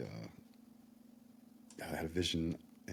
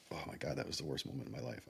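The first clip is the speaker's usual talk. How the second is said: softer, trembling with real emotion; real shaken voice, half-choked; shaky voice; 3 semitones lower, 8 dB quieter than usual. Real, not acted. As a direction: voice quivering as the memory hits. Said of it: "my jaw is just shaking".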